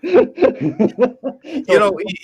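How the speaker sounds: high laughing reply. Real, not acted. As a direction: still laughing as he answers. Laughing after the joke.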